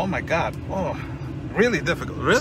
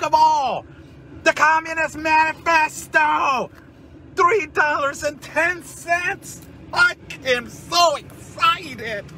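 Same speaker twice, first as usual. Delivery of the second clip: mock excitement; 7 semitones higher than usual. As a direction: gush over the book in fake delight. Mock joy over cheap Communist Manifesto.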